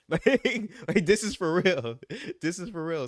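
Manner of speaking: high, loud laughing insistence; cracking up, voice jumping high. Real, not acted. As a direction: insist through your own laughter. Laughs while insisting it is real.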